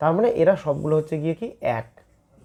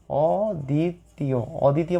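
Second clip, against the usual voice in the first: অদ্বিতীয় stretched very slowly, syllable by syllable; drawn-out word; slower than usual. Shown as a demonstration. Teacher spelling out অদ্বিতীয় for the class, slowly drawn out. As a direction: draw out অদ্বিতীয় slowly so students catch each sound. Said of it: "অদ্বিতীয় শব্দটার মানে আমাদেরকে বুঝতে হবে".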